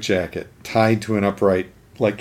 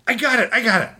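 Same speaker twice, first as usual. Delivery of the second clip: much higher, eager henchman; eager minion; 12 semitones higher, faster than usual. Retold or imitated. A minion pitching his idea to the Penguin, high and eager. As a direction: blurt it out like a keen underling. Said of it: "one of the minions finally goes".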